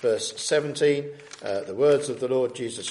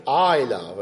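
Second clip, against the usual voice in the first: "I love" said louder, with weight on I; emphatic stress; 5 dB louder than usual. Shown as a demonstration. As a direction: lean firmly on "I love". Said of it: "It's an emphatic".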